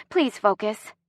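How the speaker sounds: ordinary delivery, nothing marked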